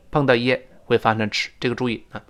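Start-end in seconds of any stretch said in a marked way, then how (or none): none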